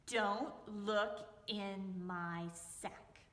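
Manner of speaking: drawn out slowly and low; slow warning. Acted. Foxy warning the woman about his sack, slow and stern. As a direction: stretch each word as a stern warning.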